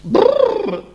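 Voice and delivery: high, loud shivering brrr from icy water; teeth-chattering cold noise. A narrator's reading. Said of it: "l'acqua era gelida".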